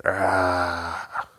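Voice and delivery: another Bigfoot cry; creature roar. Acted. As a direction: roar again, unwilling to talk. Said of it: "the Bigfoot again went".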